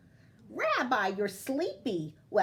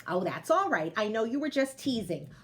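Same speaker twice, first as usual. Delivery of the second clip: usual speaking voice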